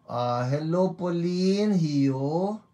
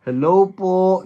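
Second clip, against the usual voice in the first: loud greeting; 4 semitones higher, 10 dB louder than usual. Real, not acted.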